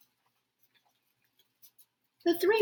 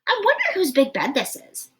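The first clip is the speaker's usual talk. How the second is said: curious Goldilocks; 9 semitones higher, 5 dB louder, faster than usual. Acted. Goldilocks voiced in story.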